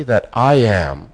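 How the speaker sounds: slow, tender affirmation; sincere. Shown as a demonstration. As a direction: savour each word like a confession of faith. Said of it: "Can you say that sincerely?".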